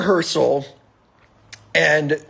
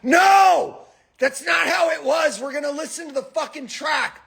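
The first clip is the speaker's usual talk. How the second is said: retold yelling; 11 semitones higher than usual. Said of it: "And you both are like".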